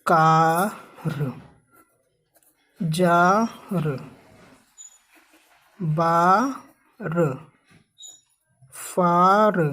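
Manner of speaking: कार and जार each stretched out slowly; teacher sounding out words slowly; slow drawn-out demo. Shown as a demonstration. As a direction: draw out कार, then जार, for the class. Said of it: "इसी तरह एक word है".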